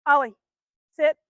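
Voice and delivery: loud, firm command to the dog. Real, not acted. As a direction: call the dog's name sharply, then the order. Commanding dog during training.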